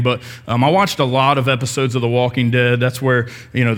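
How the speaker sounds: usual speaking voice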